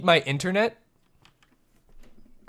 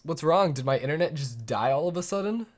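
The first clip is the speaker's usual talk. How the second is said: ordinary delivery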